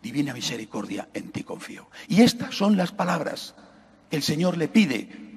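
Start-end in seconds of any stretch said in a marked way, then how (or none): 0.0-1.9 softly, dropping to a whisper; tender hushed whisper; hushed voice of the Lord. Acted. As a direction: hush it like a sacred word. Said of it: "le dice".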